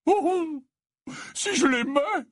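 put-on high, tearful old man's voice; high-pitched, emotional sigh of affection. A narrator's reading. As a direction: answer with eyes brimming, high and tender. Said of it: "dit Slughorn, les yeux remplis de larmes".

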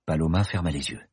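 ordinary delivery

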